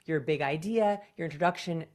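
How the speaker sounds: usual speaking voice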